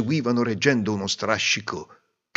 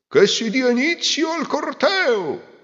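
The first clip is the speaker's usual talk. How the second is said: high, slow, louder announcement; pompous proclamation; 5 dB louder than usual. Acted. Master of ceremonies opening the parade, pompous. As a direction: proclaim it grandly. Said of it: "e disse".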